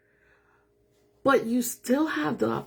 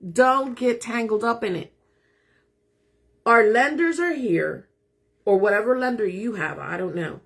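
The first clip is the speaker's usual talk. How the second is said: ordinary delivery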